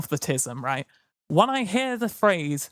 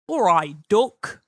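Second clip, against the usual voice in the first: Keizo impression; 3 semitones higher, 6 dB louder, slower than usual. Greeting someone as Keizo, loud and chirpy. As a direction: call out a cheery northern hello. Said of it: "Keizo impression".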